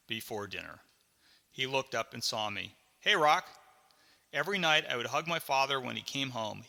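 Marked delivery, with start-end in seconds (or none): 3.0-3.5 raised, louder call; hearty greeting. Acted. Dad spots his son after work, calling out heartily. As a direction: call out hello to your kid.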